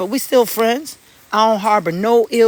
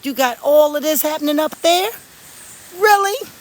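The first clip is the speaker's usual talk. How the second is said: surprised; 9 semitones higher than usual. Real, not acted. Disbelief at papaya growth.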